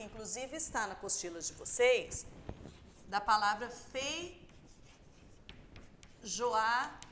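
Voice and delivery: ordinary talk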